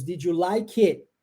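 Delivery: "Did you like it?" with the t of "it" dropped, "did you" run together as didju; swallowed final T. Shown as a demonstration. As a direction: eat the final T of "it". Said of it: "a gente come o T". (engolindo o T final de "it")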